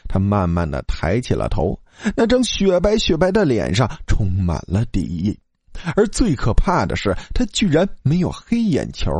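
ordinary delivery, nothing marked

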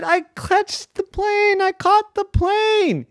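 high, slow gleeful cry; triumphant boy. Acted. Boy finally catches the plane, overjoyed. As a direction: savour the win, stretching each word up high.

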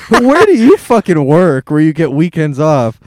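loud, indignant outburst; loud exasperated rant. Retold or imitated. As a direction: yell it in disbelief. Ranting at band lyrics.

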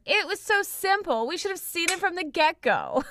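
put-on high bright chiming kid voice. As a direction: pitch it way up and chime in. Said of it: "Angela chimes in".

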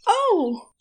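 sharp high startled oh; gasp. A narrator's reading. Angel seeing the ruined picnic, gasping. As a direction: catch your breath in shock. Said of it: "Aziraphale gasped".

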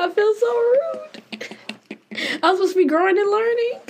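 high, whimpering fake sob through the words; hammed-up tearful apology, half laughing; mock crying. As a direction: pretend weepy, high and quick. Mock remorse after cutting in.

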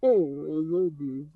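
muffled, as if through a tube; talking through a snorkel tube. Shown as a demonstration. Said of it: "that almost sounded like the pyro".

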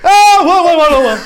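giggly panicked stammer; laughing flustered exit. Retold or imitated. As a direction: blurt it out mid-laugh, flustered. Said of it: "Boa noite".

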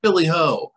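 high mock shriek. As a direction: shriek it like a startled hobbit. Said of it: "they shriek".

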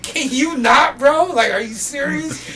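high-pitched incredulous complaint; incredulous protest. Retold or imitated. Worker to man peeing by the door, incredulous. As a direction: pitch it up, retelling exasperated disbelief.

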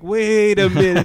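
high, loud, drawn-out balk; alarmed protest. Retold or imitated. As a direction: stall in sudden alarm, stretching the words. Balking at the shot in retold scene.

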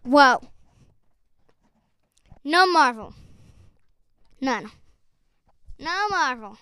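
low, stunned disbelief with long pauses; mock shock. Guest reacting to no Marvel, mock-stunned. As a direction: act dumbfounded, loud then subsiding. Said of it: "be shocked at Jordan for not knowing about Marvel".

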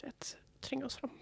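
whispered conspiratorial aside; whispered sly suggestion. Real, not acted. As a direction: breathe it like a secret plan. Joking about pushing forward.

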